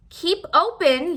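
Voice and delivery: loud, high, slow yell. A narrator's reading. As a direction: bark the order up at the fox. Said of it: "yelled Doctor DeSoto".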